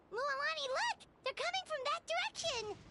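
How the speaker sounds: high-pitched urgent call to a companion; excited high cry of warning; urgent alert. Acted. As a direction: get their attention fast, pitch jumping up. Calls out to companion about enemies.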